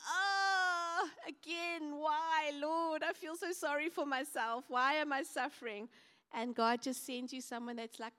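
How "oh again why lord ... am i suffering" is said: high, crying moan of self-pity; self-pitying whine. Retold or imitated. Believer complaining to the Lord in a high whine. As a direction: whimper it like a sulking believer. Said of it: "how easily do we just go".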